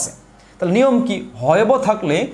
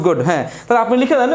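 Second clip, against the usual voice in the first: normal talking voice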